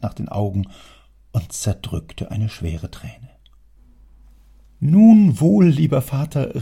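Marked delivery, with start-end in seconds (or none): 4.8-6.6 much higher, loud triumphant call; spring up and crow your win; triumphant cry. A narrator's reading. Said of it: "rief Julius ... triumphierend". (Julius ruft triumphierend, hoch und laut)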